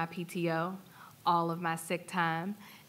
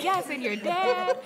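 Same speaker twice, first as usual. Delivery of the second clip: laughing; 12 semitones higher, 6 dB louder than usual. Retold or imitated. Mother answering her daughter, laughing.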